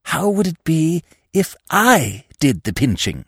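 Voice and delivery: high, hoarse stage whisper; conspiratorial rasp. A narrator's reading. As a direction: whisper the scheme hoarsely and eagerly. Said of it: "in a hoarse whisper".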